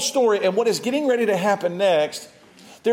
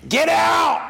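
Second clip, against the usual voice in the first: much higher, louder shouted command; demonic yell; 11 semitones higher, 4 dB louder than usual. Retold or imitated. Spirit inside the house yelling at the preachers, shrill shout. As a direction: bark it like the devil inside.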